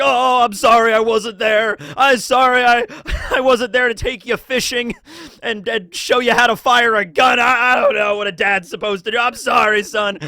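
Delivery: loud, high mock-emotional apology, half laughing; hammed-up tearful dad. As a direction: overact a father begging forgiveness. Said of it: "it's like".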